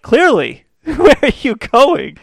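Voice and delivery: high, loud, laughing sarcasm; mock her with a high incredulous laugh; sarcastic high retort. Boyfriend sarcastically quizzing his cheating girlfriend, high and loud. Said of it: "I'm like".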